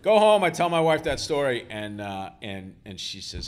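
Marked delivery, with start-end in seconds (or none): 0.0-0.4 much higher and louder, laughing, fed up; exasperated shout. Retold or imitated. Writer shouting back at the drunk, exasperated. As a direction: shout it out, go home already.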